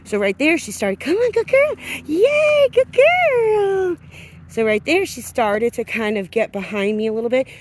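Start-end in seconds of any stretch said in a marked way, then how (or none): 1.0-4.0 much higher, louder cheering yay. Real, not acted. Trainer cheering her dog in excited baby talk.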